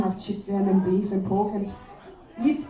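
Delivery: ordinary talk, nothing marked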